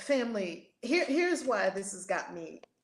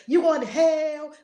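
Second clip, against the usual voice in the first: shouted in a mock commenter voice; mock hateful shout; 4 semitones higher, 9 dB louder than usual. Host quoting hateful YouTube comments, mock shout. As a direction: yell it like an angry commenter. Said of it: "comments on the YouTube video".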